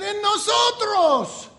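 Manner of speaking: high, loud, drawn-out response; shouted answer. Retold or imitated. Imitating the church answering where he lives, shouted. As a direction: shout it back as a whole crowd.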